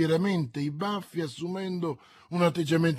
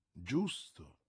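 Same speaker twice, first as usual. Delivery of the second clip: grudging admission; 10 semitones lower, 12 dB quieter than usual. A narrator's reading. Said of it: "ammise il re".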